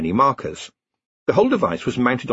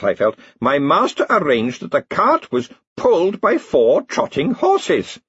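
put-on old slave, proudly explaining; 10 semitones higher, 3 dB louder than usual. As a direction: proudly lay out the master's clever plan.

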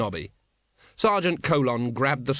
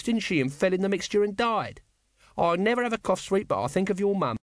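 a lightly voiced cheeky little man, higher; chirpy needling, pitched up; Nobby's needling; 8 semitones higher than usual. Nobby needles old acquaintance.

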